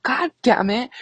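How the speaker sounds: high strained exasperated groan. Retold or imitated. Hearing sister confirm bleach, high exasperated curse. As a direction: curse fate in a squeezed voice. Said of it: "And I'm like".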